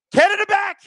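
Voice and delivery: high excited yell; excited shout. Real, not acted. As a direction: shout it out urgently. Urgent cry during attack.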